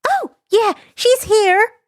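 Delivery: put-on high, bouncy girlish voice; bubbly schoolgirl on the phone; chirpy schoolgirl. Himiko chatting to the hero, high bubbly girl voice.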